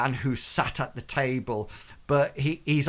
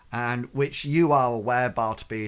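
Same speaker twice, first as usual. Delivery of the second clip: ordinary talk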